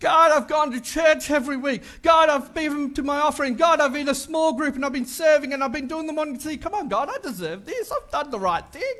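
loud, indignant listing of good deeds; indignant complaint. Retold or imitated. Pastor acting a churchgoer demanding reward, loud and indignant. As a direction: shout your grievance at God. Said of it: "I knew I couldn't be the only one".

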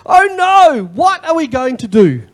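high, loud cry of panic; the frightened servant crying out to Elisha; panicked servant. Acted. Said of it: "the servant just turns around and goes".

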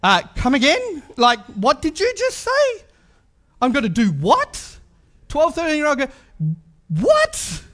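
rising disbelief ending in a high cry of what; mock-stunned girl, voice cracking up high; imagine being told the news, squeak out what; incredulous. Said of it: "I'd be like".

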